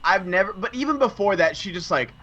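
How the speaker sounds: ordinary delivery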